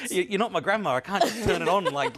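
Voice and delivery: high, loud protest through laughter; real laughing refusal. Real, not acted. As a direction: protest while still laughing hard. Laughing refusal to perform the accent.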